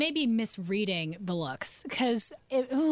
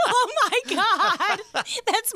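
high, drawn-out words through laughter; laughing; 10 semitones higher, 3 dB louder, much slower than usual. Real, not acted. Host reacts to being serenaded by comedian, laughing. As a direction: crack up in delight while talking.

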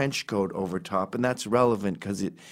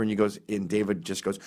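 usual speaking voice

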